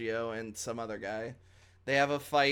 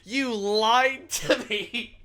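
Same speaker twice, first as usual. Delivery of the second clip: slow, high yelling accusation; angry shout; 8 semitones higher, 7 dB louder, much slower than usual. Retold or imitated.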